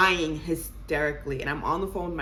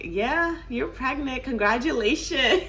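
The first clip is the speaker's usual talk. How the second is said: breezy clinic worker; 7 semitones higher than usual. Retold or imitated. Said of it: "the girl was like".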